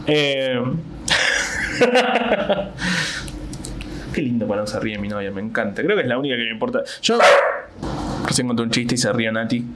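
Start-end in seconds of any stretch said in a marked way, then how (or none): 0.7-4.1 breathy laugh. Real, not acted. Laughing with girlfriend. 4.1-5.8 lower, softer, fond; tender. Real, not acted. Comic softly adoring his girlfriend's laugh, tender.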